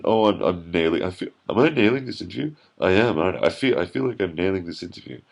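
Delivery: high, fast, loud mocking voice; smug wacky interviewee. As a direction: ham up a self-impressed goof. Mocking a self-satisfied interviewee.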